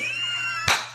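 high, breathless cackle trailing away; laughter. Real, not acted. Reader laughing at her own cheer, high wheezing laughter. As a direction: crack up helplessly at your own joke.

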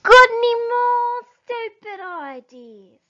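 high, loud shout to a deaf man; shouted back. Acted. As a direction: bellow it so Bean can hear. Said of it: "Bunce shouted back".

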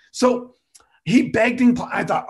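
ordinary talk

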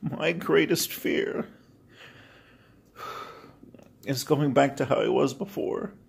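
real tears breaking through the words, voice cracking high; crying. Real, not acted. Vlogger to struggling viewers, crying as he opens up. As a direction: choke the words out through tears, slow and strained. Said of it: "Sorry, I'm crying".

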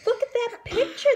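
high, fast, pushy chorus voice; demanding mole rats. Acted. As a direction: insist loudly, pointing at the portrait. Other mole rats demand loudly.

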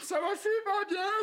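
high put-on reassurance; faked client's voice. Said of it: "faire la voix du monsieur avec ta bouche". (fausse voix aiguë du client)

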